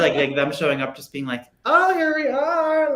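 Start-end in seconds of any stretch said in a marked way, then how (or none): 1.6-2.9 high drawn-out singsong mimicry; slow, very high mock announcement; mock grand arrival. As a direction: imitate them arriving in a high, stretched voice. Mimicking cast showing up on show.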